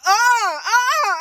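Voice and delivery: high panicked oh with laughter; flustered squeals while laughing. Retold or imitated. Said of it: "And I was like".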